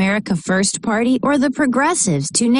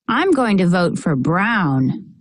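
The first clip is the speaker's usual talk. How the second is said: plain reading voice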